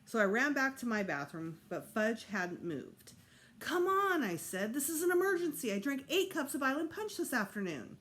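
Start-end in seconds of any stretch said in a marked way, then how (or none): 3.6-4.2 high, loud pleading; urgent plea. A narrator's reading. Desperate to use bathroom. 4.7-8.0 high, urgent pleading; desperate. A narrator's reading. Desperate to use bathroom.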